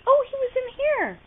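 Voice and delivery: high, fast, excited; excited discovery. Real, not acted. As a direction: rush it out with delight. Finding the cat.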